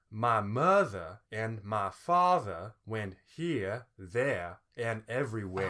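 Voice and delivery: slow Southern lilt, R's dropped; lilting, drawn-out old Southern accent. Shown as a demonstration. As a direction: swing each word slowly in a Southern lilt. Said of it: "but it sounds like this".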